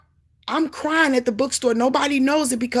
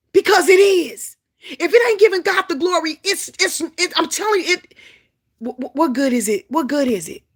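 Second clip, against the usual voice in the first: raised, fervent preaching; preaching at full force; 6 semitones higher, 4 dB louder than usual. Real, not acted. Preacher urging the congregation, fervent and loud. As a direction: drive it home to the church.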